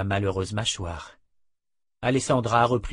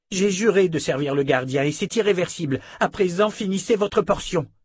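raised, insistent character voice; defiant dark sister; 9 semitones higher, 5 dB louder than usual. Acted. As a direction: shut down the argument, pitched high. Alessandra refuses, orders abbess to eat.

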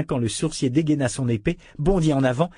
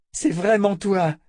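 high, slow, raised question; amazed recognition; 9 semitones higher, 5 dB louder, slower than usual. Acted. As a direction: ask in dawning disbelief. Richard recognises his old friend. (stupéfaction joyeuse)

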